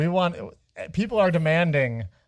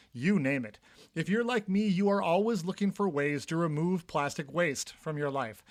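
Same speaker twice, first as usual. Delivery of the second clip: steady, softer sponsor read; 7 dB quieter than usual. Acted. Reads an ad spot.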